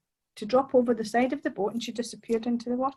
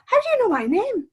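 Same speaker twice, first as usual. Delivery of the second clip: much higher, startled question; astonished; 12 semitones higher, 5 dB louder than usual. Acted. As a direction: blurt it out in shock. Tom astonished by mermaid.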